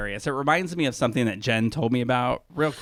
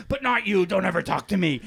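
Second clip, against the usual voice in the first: higher imitated sharp dismissal; mock hostile snub; 5 semitones higher than usual. Angela singling out one housemate, mock hostile. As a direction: turn abruptly on one person, hammed-up. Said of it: "then she goes".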